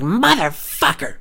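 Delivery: high, drawn-out curse; retold rage. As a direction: stretch the curse in mock rage. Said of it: "so much rage and anger".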